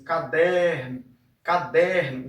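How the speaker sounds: "caderno" called out with "der" drawn out; calling out. Shown as a demonstration. Teacher demonstrating the stressed syllable, "caderno" called out with long "der". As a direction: call "caderno" like a name, stretching the middle "der". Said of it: "falar a palavra como se estivesse chamando".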